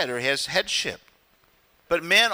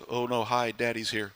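low, soft, deflated greeting; dreading kids; 6 semitones lower, 7 dB quieter than usual. Retold or imitated. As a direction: mumble like a child dreading dad. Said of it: "it's like".

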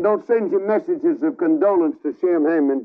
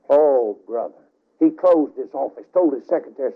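usual speaking voice

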